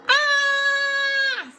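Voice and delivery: long, high-pitched scream; high yell. Acted. SpongeBob yells as the monster reaches for him, terrified. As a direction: yell out in cartoon terror. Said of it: "SpongeBob yelled".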